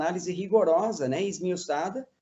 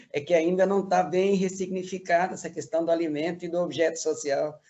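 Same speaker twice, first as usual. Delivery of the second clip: ordinary talk, nothing marked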